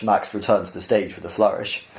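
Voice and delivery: usual speaking voice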